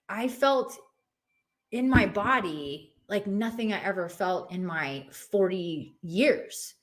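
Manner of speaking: normal talking voice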